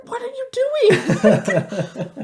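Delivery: high, loud, slow mock yelling through a laugh; mother replaying her own snap at daughter, laughing shriek. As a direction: ham up your own outburst, laughing.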